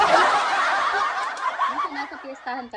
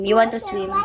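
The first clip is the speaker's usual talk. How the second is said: normal talking voice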